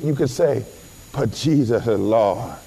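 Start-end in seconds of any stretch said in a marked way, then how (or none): 1.1-2.6 put-on low, slurred drunk voice; mock drunk. Preacher acts out drunken believer confessing, low and slurred. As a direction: slur it loosely, dropping the endings. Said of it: "even in your drunken state".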